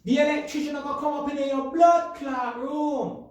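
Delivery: high, hammed-up yell of a mum calling her son; mock mum shouting through the house; joking mum impression. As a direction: holler like an exaggerated Jamaican mum, stretched out and shrill. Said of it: "if that was my mum".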